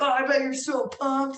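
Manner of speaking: high, loud hyped-up friend; excited friend. Retold or imitated. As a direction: imitate a friend thrilled for you. Retold friend reacting with excitement.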